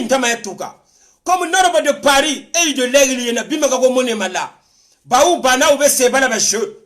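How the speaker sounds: ordinary talk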